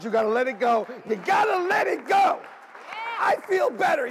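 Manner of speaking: loud, rising preacher shout; preaching at full force. Real, not acted. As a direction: drive it home to the congregation.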